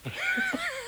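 very high cackling laugh; teasing laugh. Acted. Husband laughing at his wife after faking death. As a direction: burst out laughing at the fright you caused.

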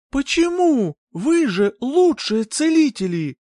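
high, loud cry of disbelief; shocked outcry. Acted. Shocked by terrible news. (потрясённый вскрик)

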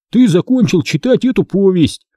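high, emphatic mock announcement; indignant quoting. Boy indignantly quotes book's ending. (возмущённо цитируя, высоким голосом)

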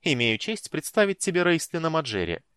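teasing. Acted. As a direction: tease the little brother proudly. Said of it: "не устояв перед искушением немного поддразнить брата".

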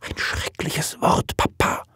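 shocked whisper. Acted. Daughter cutting off Papa's marriage talk, horrified whisper. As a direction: whisper it as if the word were unspeakable.

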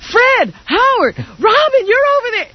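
mock yelling. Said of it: "screaming out his name".

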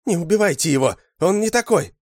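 high, loud, desperate plea; woman screaming. A narrator's reading. Woman begs soldiers to spare him, desperate scream. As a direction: beg them at the top of a high female voice. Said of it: "закричала женщина".

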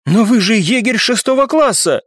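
high, protesting cry; exclaiming. A narrator's reading. Оксана appealing to the captain, exclaiming. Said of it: "воскликнула Оксана".

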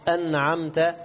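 "أنعمت" ending in ta; correct fatha. Shown as a demonstration. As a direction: say "أنعمت" as an'amta. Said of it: "خطأ يبطل الصلاة". («أنعمت» بفتح التاء)

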